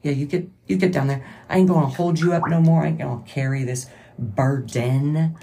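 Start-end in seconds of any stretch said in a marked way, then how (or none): none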